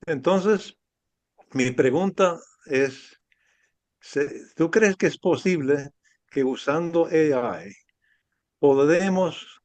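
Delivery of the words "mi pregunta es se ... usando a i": ordinary talk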